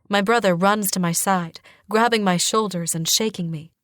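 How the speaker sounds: ordinary delivery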